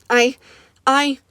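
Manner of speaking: sobbing. Acted. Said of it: "his sobs echo through the room".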